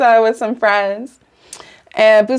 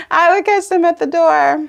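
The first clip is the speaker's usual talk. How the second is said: higher pitch, fresh out of a laugh; amused; 9 semitones higher than usual. Real, not acted. Cracking up at the memory of catching them at the door, amused. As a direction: repeat it high through real amusement. Cracks up repeating her own line.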